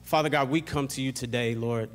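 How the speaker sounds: soft, earnest prayer voice over music; hushed heartfelt pastor's prayer. Acted. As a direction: pray quietly and sincerely before the sermon. Leads congregation in prayer.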